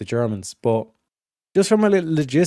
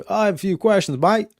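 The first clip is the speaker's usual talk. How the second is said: put-on British accent, higher pitched; mock British guy; 7 semitones higher than usual. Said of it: "the British guy goes".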